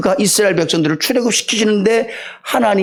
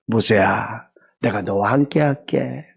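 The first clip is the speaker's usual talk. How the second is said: much lower and softer, gentle reassurance; tender God; 10 semitones lower, 5 dB quieter, slower than usual. Acted. God comforting a discouraged Moses, low and tender. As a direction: call him by name like a loving father.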